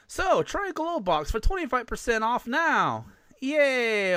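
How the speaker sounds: high chipper ad voice; fake cheerful. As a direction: force cheer over the chaos. Cheery ad sign-off amid chaos.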